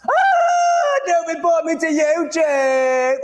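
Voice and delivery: high, slow wailing fake sob; mock crying plea. Streamer fakes begging a viewer not to report him, mock wail. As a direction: wail it like a pretend victim.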